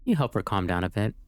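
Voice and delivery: usual speaking voice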